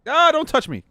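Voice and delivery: high, loud panicked shriek; real squealing alarm; freaked out. Real, not acted. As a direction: scream as a moth comes at you. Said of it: "Very freaked out and uncomfortable".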